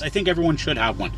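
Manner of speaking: ordinary talk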